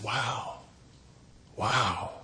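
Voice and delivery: soft, low, awestruck wow; hushed awe. Acted. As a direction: breathe it quietly, overcome by wonder. Junior awed by the golden bull.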